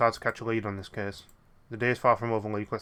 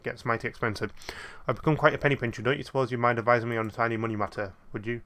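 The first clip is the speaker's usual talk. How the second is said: ordinary delivery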